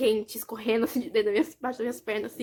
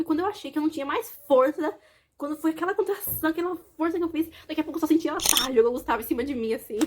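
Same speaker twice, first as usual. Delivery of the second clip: ordinary talk, nothing marked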